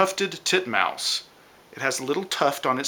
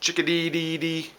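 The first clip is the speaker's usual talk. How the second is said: lightly voiced bird call; chickadee call imitation; 3 semitones higher, 3 dB quieter than usual. Teacher showing the chickadee's call, light bird voice. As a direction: toss off a little chickadee call. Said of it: "it says chickadee".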